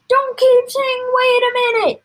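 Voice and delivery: very high, loud yell; exasperated father yelling; impatient shout. A narrator's reading. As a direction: shout down the interruption. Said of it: "shouted Mister Cranky".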